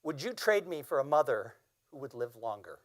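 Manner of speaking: usual speaking voice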